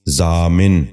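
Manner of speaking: ضامن ending in "-min", "zaa-min"; correct pronunciation. Shown as a demonstration. Teacher giving viewers the model, correct pronunciation. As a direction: say ضامن as zaamin. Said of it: "دوبارہ سنیے".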